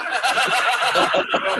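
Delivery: loud burst of laughter; hearty laugh. Real, not acted. Telling a team story, a loud burst of laughter.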